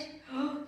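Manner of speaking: mock gasp. Mock shock at the bully.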